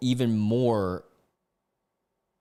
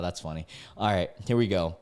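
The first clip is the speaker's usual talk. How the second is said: ordinary delivery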